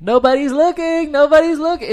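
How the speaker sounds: very high, loud mock scream. Imitating a kid as the friend runs off, high-pitched screaming. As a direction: shriek it like an excited kid. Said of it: "and screaming it".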